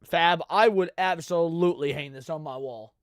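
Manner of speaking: normal talking voice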